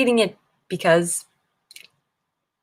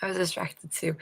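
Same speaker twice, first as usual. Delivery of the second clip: whisper; 2 semitones lower, 12 dB quieter than usual. Real, not acted. Confessing a secret to chat.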